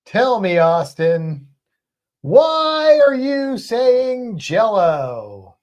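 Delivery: loud, high, drawn-out punk-singer impersonation. Acted. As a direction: stretch it out theatrically, high and loud, sliding down at the end. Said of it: "hard to do a [name] accent".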